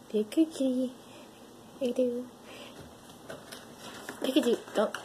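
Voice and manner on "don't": ordinary talk, nothing marked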